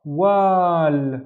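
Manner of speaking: WAL with the a held twice as long; long, drawn-out "wal"; long vowel. Shown as a demonstration. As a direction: stretch the "aa" in "wal". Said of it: "the wa is twice as long".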